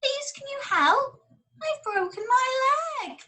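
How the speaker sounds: an injured dog begging for help; whimpering old dog. Acted. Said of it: "An old dog lay whimpering".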